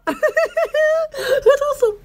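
slow, high, laughing through the words; delighted laughing awe. Real, not acted. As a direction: let the laugh carry it out. Delighted by surround sound.

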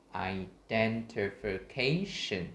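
"Identification" stretched out, peaking on "ca"; very slow "Identification", each stress lifted; clear word stress. Shown as a demonstration. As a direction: draw out "Identification" and let "ca" rise, then fall. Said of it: "mình sẽ đọc rõ ràng hơn".

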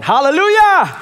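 high, full-voiced shouted hallelujah; praise shout. Real, not acted. Praise cry to congregation.

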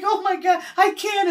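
high, loud mock-panic wail; retold panicked screaming. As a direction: act out a helpless shriek for the story. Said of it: "you just scream and holler".

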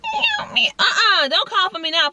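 high, sassy voiced cat refusing. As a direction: play the cat snubbing her owner.

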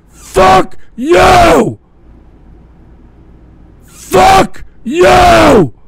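loud, high furious scream. Real, not acted. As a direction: scream it at them. Screaming off the air.

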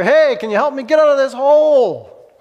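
hammed-up yell for rescue; shout for help. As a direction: holler up to the preacher for help. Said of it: "he shouts up to the preacher".

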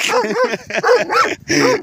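high, startled laugh; a high nervous laugh as the snake thrashes. Real, not acted.

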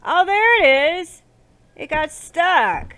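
high, loud, eager; excited discovery. Real, not acted. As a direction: spot it and call out, thrilled. Finds the item stuck.

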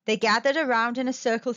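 plain reading voice

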